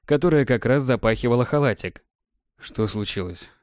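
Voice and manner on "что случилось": sleepy mumble. A narrator's reading. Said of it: "Спросонья пробормотал я".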